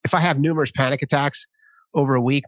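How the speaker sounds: usual speaking voice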